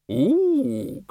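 high, long-drawn "ook" hoot; Librarian's ape hoot. A narrator's reading. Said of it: "That was the librarian".

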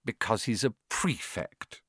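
soft, proud motherly tone; fondly. Acted. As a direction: say it with fond pride. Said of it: "said their mother fondly".

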